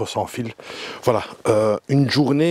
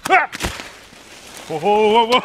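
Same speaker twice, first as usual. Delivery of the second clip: loud startled oh oh oh; alarmed cries, raised voice; surprised; 11 semitones higher, 4 dB louder than usual. Real, not acted. Heavy shower about to fall.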